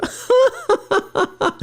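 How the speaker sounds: a sudden high-pitched laugh; high bursting laugh. Real, not acted. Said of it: "you literally sound like my French teacher".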